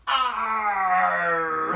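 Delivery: pirate cry after counting; pirate arr. Shown as a demonstration. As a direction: growl the magic pirate word. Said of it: "say the pirate magic word R".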